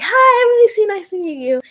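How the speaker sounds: high-pitched, excited greeting that bursts out loud. Acted. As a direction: introduce yourself high and bubbling with excitement. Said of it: "she's very excited, shaking her head very excitedly".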